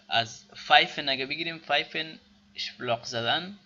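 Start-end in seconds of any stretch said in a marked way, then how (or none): none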